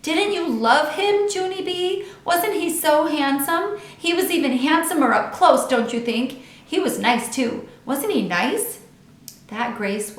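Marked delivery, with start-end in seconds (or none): 0.0-8.8 put-on high, gushing excited girl; bubbly high-pitched swoon; happy and sparkly. A narrator's reading. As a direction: gush over the handsome boy. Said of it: "Lucille was happy and sparkly".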